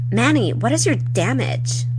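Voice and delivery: high, swooping mock indignation; acted confrontation. Acting out a teen quarrel with Manny, mock indignant. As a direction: challenge them with big pitch swoops.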